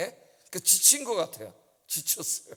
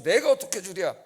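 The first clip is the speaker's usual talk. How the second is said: mock boast; 7 dB louder than usual. Pastor mimics Moses boasting.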